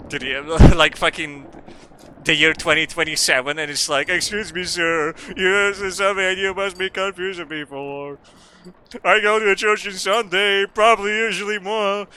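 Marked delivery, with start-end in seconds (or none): 4.1-12.1 higher, singsong put-on voice; put-on stranger voice. Imagined stranger in twenty twenty-seven approaching the streamer, put-on voice. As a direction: act out a stranger, pitched up then sinking by the end.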